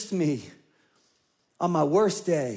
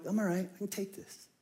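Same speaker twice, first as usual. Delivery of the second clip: much softer, hushed reassurance to himself; a near-whispered brave face; quiet self-talk; 10 dB quieter than usual. Retold or imitated. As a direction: talk yourself down under your breath. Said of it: "I'm like".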